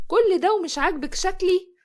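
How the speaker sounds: a wounded cry of unfairness; hurt protest. Acted. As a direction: push back, stung and fed up. Zahra protests to sister-in-law.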